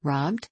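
"Robbed?" swept upward as a question; rising inflection. A narrator's reading. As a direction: let "Robbed?" climb to its end. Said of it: "with a strong rising inflection".

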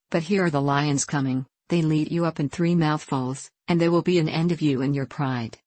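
ordinary delivery

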